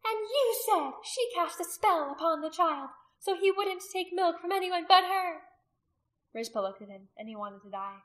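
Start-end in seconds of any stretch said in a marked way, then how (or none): 0.1-5.4 accusing woman. A narrator's reading. Accuser to a man over a bewitched child, high and loud. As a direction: press the charge loudly in a high voice.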